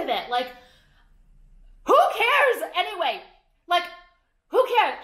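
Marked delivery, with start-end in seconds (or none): 1.8-5.0 higher and louder, throwing it away; exasperated outburst. Real, not acted. Shrugging off fans' hat questions, loud exasperated outburst. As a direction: fling it off, done caring.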